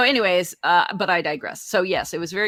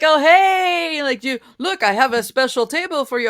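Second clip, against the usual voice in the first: high, louder fake welcome; mock-friendly waiter; 10 semitones higher, 8 dB louder than usual. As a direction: play the over-eager host. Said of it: "And they're like".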